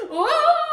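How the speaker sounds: high, loud laughing yelp; laughing scream. Retold or imitated. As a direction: shriek through laughter. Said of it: "screaming right in your face".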